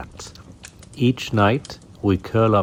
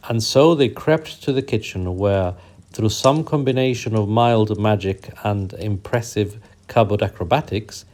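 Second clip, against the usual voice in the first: ordinary delivery, nothing marked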